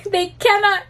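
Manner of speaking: high-pitched, breaking into laughter; laughing. Real, not acted. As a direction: say it through real laughter, pitch jumping up. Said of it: "I'm laughing because there's a scene".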